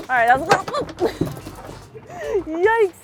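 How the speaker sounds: loud, fast and breathless; rattled by a wild gaff; excited. Real, not acted. Chaotic gaff, laughing, yelling.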